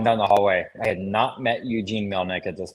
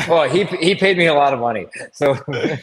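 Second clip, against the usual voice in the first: laughing; 7 semitones higher, 5 dB louder than usual. Real, not acted. Joking about being paid.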